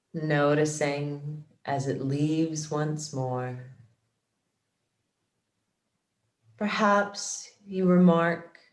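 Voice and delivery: measured, calm yoga nidra guidance, phrase by phrase; guided meditation. Acted. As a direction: guide them into relaxation, unhurried and steady. Leads guided relaxation practice.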